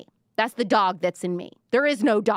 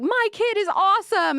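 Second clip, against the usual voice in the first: high, louder gushing proud-parent voice; doting parent; 12 semitones higher, 5 dB louder than usual. Retold or imitated. Parent bragging about their kid, gushing high and loud. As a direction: gush like a parent who thinks their kid is perfect.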